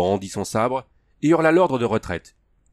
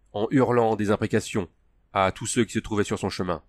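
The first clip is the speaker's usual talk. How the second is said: usual speaking voice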